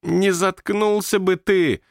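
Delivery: high, drawn-out yell; annoyed shout. A narrator's reading. May to the noisy ghost from her room, shouting. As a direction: call out crossly from another room. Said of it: "крикнула из своей комнаты Мэй".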